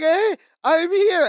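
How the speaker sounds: slow, high-pitched imitation; mock attention-seeking. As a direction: draw it out high, as if angling for the camera. Mimicking a man hogging the camera.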